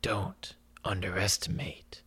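stubborn whisper. Acted. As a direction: whisper it proudly. Mule character answering back.

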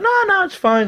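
squeaky high-pitched protest. Retold or imitated. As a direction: squeak it out innocently. Drunk reply to wife, very high.

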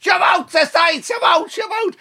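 high, forceful sideline holler; coach's shout. Retold or imitated. Coach to his young lad on the pitch, shouting. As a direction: imitate a Clare coach yelling orders. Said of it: "He's shouting to his young lad".

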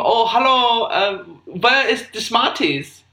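slow, hesitant German shopper; normal German accent. Acted. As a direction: enquire as a comic German customer. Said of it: "Normal German walks into a shop".

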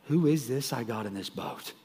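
hushed, understated dread; soft mock-awed fright; retold terror. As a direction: imitate terrified men speaking low and soft. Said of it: "They were terrified".

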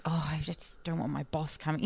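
soft anxious whisper; worried whisper. Retold or imitated. Jackie fretting her boss sees tweets, whispering. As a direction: whisper it like a secret worry.

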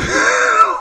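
squealing laugh; loud high cackle; high burst of laughter. Real, not acted. Laughing at mocked crying.

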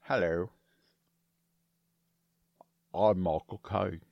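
deep, slow celebrity impression; Michael Caine. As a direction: drop low and draw the words out. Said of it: "It was slower and deeper".

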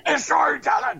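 high, loud mocking shout; mock fan scream. Cohost imitating his friend screaming at a show, mock fan scream. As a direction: holler it like an overexcited superfan. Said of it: "you're gonna scream".

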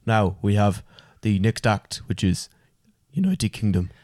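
low, unhurried Irish host calling the next act; Terry Wogan impression. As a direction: announce the next act like the old Irish commentator. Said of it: "That sounded more like him then".

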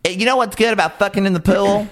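high, lightly hammed-up voice; put-on character voice. Character talking about the pool, high put-on voice. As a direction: pitch it up as a teasing joke.

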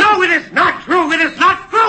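accused officer denying treason; protest. Acted. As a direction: protest it with rising force. Accused protests at his trial.

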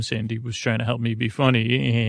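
ordinary talk

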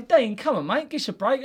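high, pleading mock protest. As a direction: plead with him, hammed up. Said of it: "I say".

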